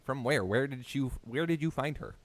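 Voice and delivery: normal talking voice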